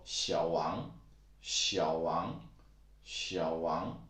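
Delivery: Xiao Wang with second tone then third tone, slowly; careful Mandarin tone model; Xiao Wang tone demo. Shown as a demonstration. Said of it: "That's how it sounds".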